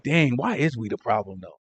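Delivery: mock naive wonder. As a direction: pretend to be puzzled, quietly. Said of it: "Sometimes you gotta act, like".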